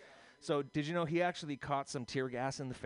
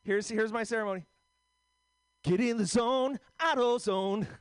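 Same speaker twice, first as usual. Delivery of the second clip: higher, earnest comic opening his set; Pancake impression; 9 semitones higher than usual. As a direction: play a nervous comic starting with a ritual, pitched up. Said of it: "Here's my impression of Pancake".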